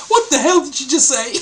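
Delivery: high, laugh-filled imitated shock; laughing mock disbelief. Host imagines the man's reaction, laughing. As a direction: voice his shock through your laughter.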